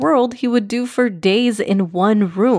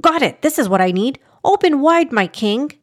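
frantic; 6 semitones higher than usual. Acted. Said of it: "frantically addressed the king".

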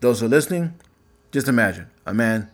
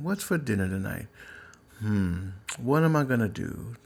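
quiet inner musing; 7 dB quieter than usual. Retold or imitated. His inner thoughts while ignoring boss.

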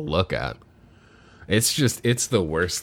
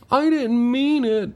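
plant voice; 4 dB louder, slower than usual. Retold or imitated. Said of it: "And he says".

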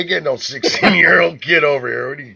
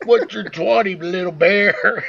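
higher, louder mock trucker on the CB; hammed-up radio chatter to a buddy; 6 semitones higher, 5 dB louder than usual.

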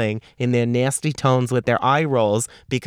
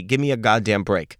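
ordinary talk

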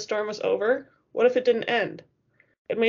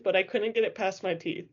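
ordinary delivery

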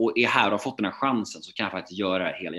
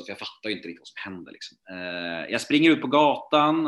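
ordinary talk, nothing marked

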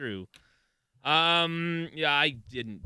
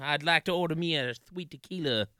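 drawling Texas wrestler voice; Dusty Rhodes impression; 5 semitones higher, 6 dB louder than usual. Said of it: "a Dusty Rhodes impression".

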